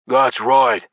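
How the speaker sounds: mild fierce growl; growled agreement. A narrator's reading. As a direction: growl it out with force. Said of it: "Hung growled".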